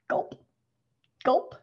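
plain reading voice

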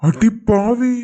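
loud, drawn-out exclamation; aghast husband; shocked outrage. Acted. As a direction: burst out at a betrayal just overheard. Husband overhears his wife's real motive.

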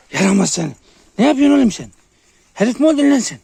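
very high, shouted telling-off; loud scolding. Real, not acted. Telling off the misbehaving dog.

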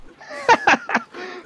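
high, breathless squeal of a laugh; giddy high laugh. Acted. Lineback dreaming of gold, giddy high laugh. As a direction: giggle with greedy delight.

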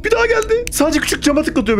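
high alarmed blurting. Real, not acted. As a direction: blurt out the sighting. Alarmed at new monster.

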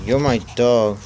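ordinary talk, nothing marked

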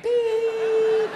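long, high, loud monitor tone; loud sustained beep; flatline beep. Acted. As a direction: imitate a heart monitor flatlining. Imitates a heart monitor flatlining.

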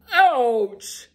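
loud, high ogre yelp of pain; startled loud ouch; ogre scream. Acted. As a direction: shriek it like a plucked ogre. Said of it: "the ogre screamed".

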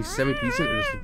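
very high squeaky cat voice; kitten voice. Pretend. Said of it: "are you ready for this cat impression".

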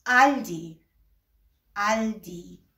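آلدی said with the German A, between آ and ا; correct German Aldi. Shown as a demonstration. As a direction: say it the right German way. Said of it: "Aber es heißt".

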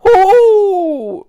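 long, high, very loud whoop; wild hollered "hoo" falling at the end; whooping howl. A narrator's reading. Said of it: "stimmte eine zweite Stimme ein". (lang gezogenes Juchzen)

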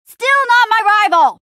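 high, loud retort; exasperated shout. Acted. Rejecting a rival's claim, exasperated shout. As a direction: throw it back loudly at your rival.

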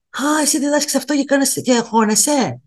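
high, mocking voice for the critics. A vlogger mimics her critics, high and mocking. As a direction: mimic the know-it-alls who catch her out. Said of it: "ξέρετε πόσες φορές μου έχουν πει".

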